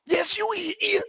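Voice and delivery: whispered high mockery. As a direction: breathe it mockingly. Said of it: "pet peeve".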